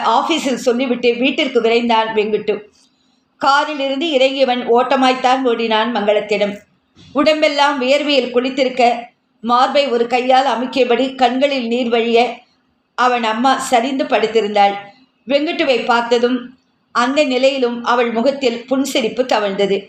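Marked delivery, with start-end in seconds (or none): none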